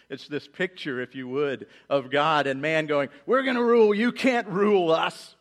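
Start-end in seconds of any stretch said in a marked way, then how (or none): 3.3-5.3 higher, louder mock-defiant boast; hammed-up rebellious child shouting. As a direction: play a cocky toddler taunting dad. Mocking defiant mankind.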